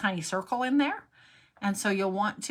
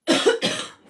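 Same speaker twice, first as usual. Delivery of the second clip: coughing fit; 9 dB louder than usual. Real, not acted. Coughing repeatedly during stream.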